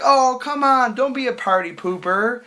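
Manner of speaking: high, whiny imitation of pleading; mock coaxing. Girl calling him a party pooper, mimicked wheedling.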